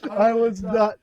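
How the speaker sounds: slow words squeezed out through laughter; crying with laughter as you speak; laughing to tears. Real, not acted.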